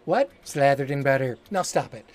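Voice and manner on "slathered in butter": sultry. Acted. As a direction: lay it on seductively. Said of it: "That's not how you normally talk".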